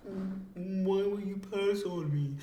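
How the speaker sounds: high, soft, hurt pleading; mock upset whine. Roger upset that Shocky passed on him, mock upset whine. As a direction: play a wounded, rejected suitor. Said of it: "And then Roger gets upset".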